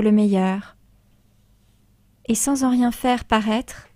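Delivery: measured verse reading with long pauses; poised, understated poetry reading; poem recitation. Acted. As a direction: recite line by line, letting each verse rest. Reads a listener's poem aloud.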